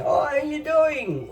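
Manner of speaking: high, slow mock of a foreign dad's English greeting, lightly done; imitating dad's broken English. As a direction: mimic an immigrant father trying to sound Aussie. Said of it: "he still speaks with his broken English".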